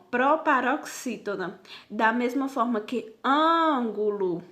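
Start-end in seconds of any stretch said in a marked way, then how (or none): 3.2-4.4 "ângulo" with a long, stretched ÂN; stress on first syllable. Shown as a demonstration. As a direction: draw out and lean on the ÂN of "ângulo". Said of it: "ele sobressai".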